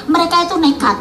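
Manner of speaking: ordinary talk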